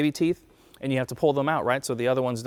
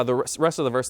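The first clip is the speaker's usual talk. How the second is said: normal talking voice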